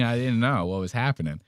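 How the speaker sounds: usual speaking voice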